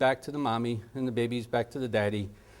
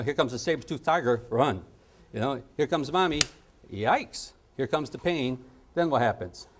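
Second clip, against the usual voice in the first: ordinary delivery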